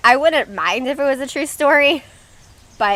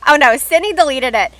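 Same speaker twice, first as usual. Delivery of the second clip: usual speaking voice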